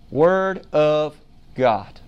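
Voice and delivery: slow, low Word of God; slow emphatic answer. Real, not acted. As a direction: let each word land.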